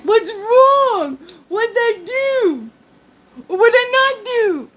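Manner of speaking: high, whining mock husband voice; hammed-up wounded husband; whiny husband. Husband voiced whining, laugh heard.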